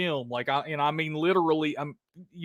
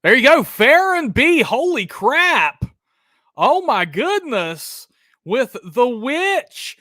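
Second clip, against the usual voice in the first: excited cheer; 7 semitones higher, 6 dB louder, slower than usual. Real, not acted. Cheering a correct answer.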